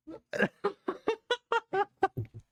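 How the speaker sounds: very high, breathless giggle of disbelief; squealing giggle; high giddy laugh. Real, not acted. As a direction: laugh out in shocked delight. Reacting to the winning line.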